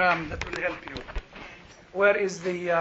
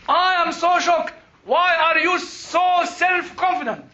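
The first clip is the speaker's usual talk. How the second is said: mock shocked doctor; 7 semitones higher, 5 dB louder, slower than usual. Doctor shocked at his confidence.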